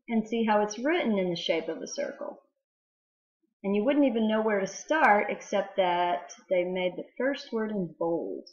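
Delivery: usual speaking voice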